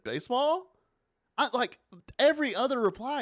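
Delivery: baseball? pitched high in disbelief; incredulous question. Real, not acted. Host baffled that fans keep saying baseball, incredulous. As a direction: ask it like you can't believe it.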